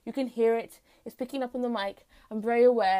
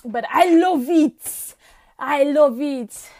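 drawn-out bad French accent, louder; 9 dB louder, much slower than usual. Vlogger enjoying her own bad French accent. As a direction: relish it in a hammy French accent. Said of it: "Excuse the bad French accent".

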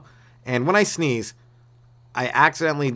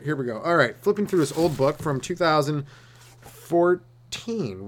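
ordinary talk, nothing marked

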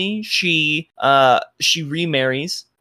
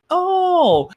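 sudden high oh; 4 dB louder than usual. Real, not acted. DM reacting to the vision.

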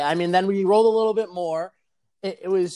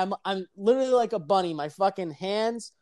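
ordinary talk, nothing marked

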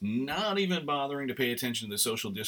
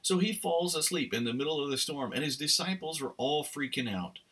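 ordinary talk, nothing marked

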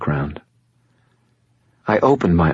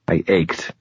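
plain reading voice

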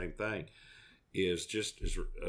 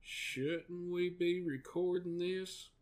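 voicing Junior; 9 semitones higher, 4 dB quieter, much slower than usual. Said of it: "and he goes".

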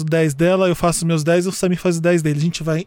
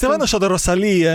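normal talking voice

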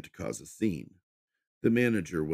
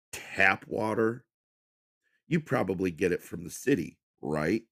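condescending; 4 semitones higher, 4 dB louder than usual. A narrator's reading. Rude customer berates waitress.

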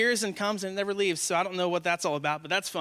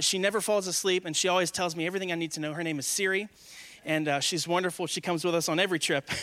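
ordinary talk, nothing marked

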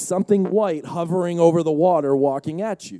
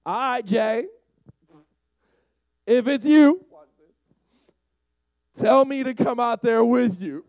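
loud, raised put-on voice; Peter; 6 semitones higher, 6 dB louder, slower than usual. Peter calling to Jesus from the boat, put-on voice. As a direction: call out boldly across the water. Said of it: "that's how he talks like that in my brain".

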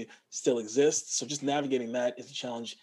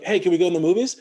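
plain Canadian question, loud and a bit raised; Canadian inflection; 4 semitones higher, 8 dB louder, faster than usual. Shown as a demonstration. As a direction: ask it the everyday Canadian way. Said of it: "Canadians would be like".

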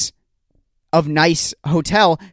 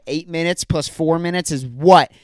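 ordinary talk

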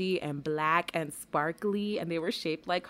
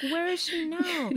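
anxious high; 12 semitones higher, slower than usual. Retold or imitated. Anxious question retold.

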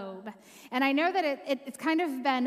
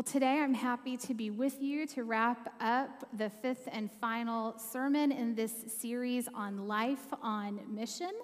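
ordinary talk, nothing marked

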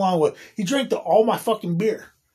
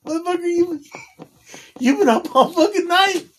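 much higher incredulous question; 11 semitones higher than usual. Retold or imitated. Asking a roommate if he was up all night, incredulous. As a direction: sound stunned he never slept.

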